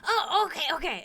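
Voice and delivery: high, delighted kobold; kobold voice lighting up. Acted. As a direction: brighten up with relief. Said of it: "he, like, lights up".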